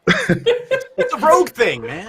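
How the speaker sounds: hammed-up rogue through laughter; laughing rogue voice. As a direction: drag it out while cracking up. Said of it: "Why did you start talking like that".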